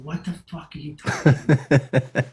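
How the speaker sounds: laughing through an incredulous curse; half-laugh it in disbelief; laughing scold. Retold or imitated. Agent laughing at the photographer's refusal.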